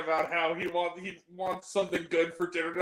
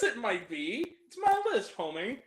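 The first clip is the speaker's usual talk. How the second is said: usual speaking voice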